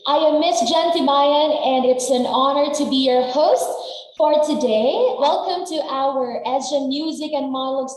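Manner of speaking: high, loud, projected opening greeting; event host announcement. Real, not acted. Host greeting all guests at the music event, projected announcer voice. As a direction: welcome the crowd with big, ringing projection.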